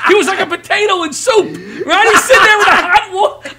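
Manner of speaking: high, loud laughing shout; laughing outrage. Real, not acted. Comedian telling host about dad, shouting with laughter.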